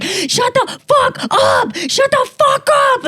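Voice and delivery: high, slow, yelled imitation of an angry brother; mock angry yell. Brother yelling at the parrots at dawn, mock angry yell. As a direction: bellow it slowly at the birds. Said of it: "He's like".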